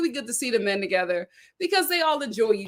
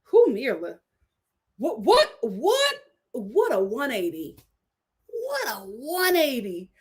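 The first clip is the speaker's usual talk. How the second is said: shocked disbelief. Real, not acted. Shocked at Mirla's turn.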